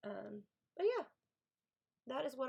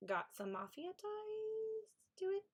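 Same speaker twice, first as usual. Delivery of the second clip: higher, slow, softer hint; teasing intrigue; 10 semitones higher, 4 dB quieter, much slower than usual. Real, not acted. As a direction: dangle it like a secret. Teasing mafia element.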